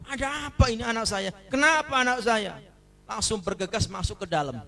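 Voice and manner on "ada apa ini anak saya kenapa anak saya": panicked mother. Acted. Mother hears her baby crying.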